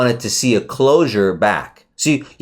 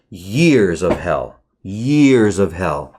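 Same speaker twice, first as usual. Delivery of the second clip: slow emphasis; 3 semitones lower, much slower than usual. Real, not acted. Repeated for emphasis.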